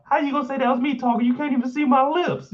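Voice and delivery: fast, high-pitched mock protest; mock indignant Kendall. Repeating Kendall's denial to the viewers, high mock indignation. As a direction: rattle it off high, imitating denial. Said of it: "Kendall said I was very quiet".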